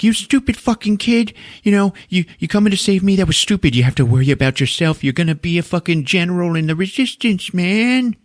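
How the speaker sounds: high shrill scolding; mock angry mom. Sarah yelling at John in the car, high mock scolding. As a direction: tear into the kid.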